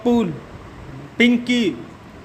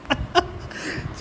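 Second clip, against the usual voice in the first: high breathy laugh; 9 semitones higher, 8 dB quieter than usual. Real, not acted. Laughing at own joke.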